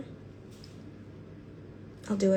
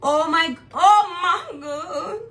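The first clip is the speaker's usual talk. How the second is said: laughing cry; 7 semitones higher, 5 dB louder, much slower than usual. Real, not acted. Overwhelmed by the colours.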